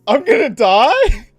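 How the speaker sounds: normal talking voice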